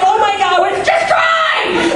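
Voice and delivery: high-pitched mock-frantic cry; panicked yelp. Comedian replays ordering the getaway driver to go, panicked yelp.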